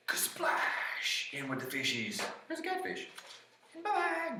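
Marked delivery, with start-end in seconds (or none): 0.1-1.3 whispered, breath-filled splash; splash sound. Acted. Troll hitting the water, whispered splash. 2.5-4.4 very high, soft squeak; tiny troll voice. Acted. Troll among the fishes saying goodbye, tiny squeak.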